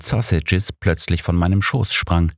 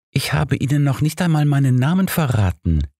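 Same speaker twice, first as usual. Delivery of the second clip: higher, cooing flirtatious woman; cooing lady; 9 semitones higher than usual. A narrator's reading. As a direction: coo it into his ear. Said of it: "säuselte mir die Frau ins Ohr".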